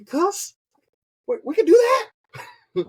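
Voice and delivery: high, shouted mock disbelief; mock amazed. Speaker reacting to the cussing, shouted mock surprise. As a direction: yelp it like you just got permission. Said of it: "I'm like".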